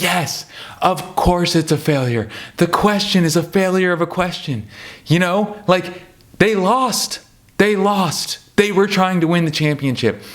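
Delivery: heated fan venting his frustration; getting worked up. Real, not acted. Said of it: "now I'm getting worked up".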